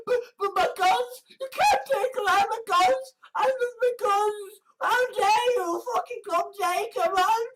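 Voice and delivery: very high-pitched mock whine; protesting gun owner.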